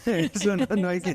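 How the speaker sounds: laughing. Real, not acted. Host teasing the guest about her title, laughing. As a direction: laugh while insisting she is.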